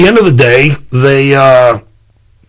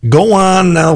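ordinary talk

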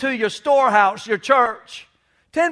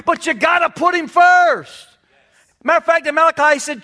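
higher, louder preaching about giving; fired-up preaching; 4 semitones higher, 3 dB louder than usual. Real, not acted. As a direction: preach it to the congregation, building force.

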